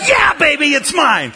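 high, loud crowing shout of victory; triumphant gloat. Retold or imitated. Preacher gloating over the armrest, high triumphant shout. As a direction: crow it like you just won the armrest.

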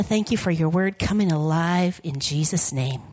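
ordinary talk

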